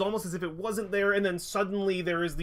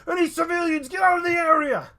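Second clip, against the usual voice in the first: high loud shout to bystanders; yelling; 12 semitones higher, 8 dB louder than usual. Acted. Guard warning civilians to flee, high loud yell. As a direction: bellow it down the alley. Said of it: "That's like yelling".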